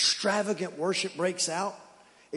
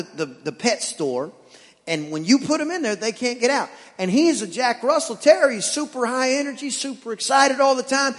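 usual speaking voice

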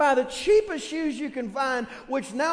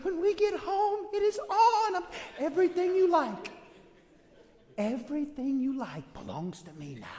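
mock parent threat; 4 semitones higher than usual. Parent threat to kids again.

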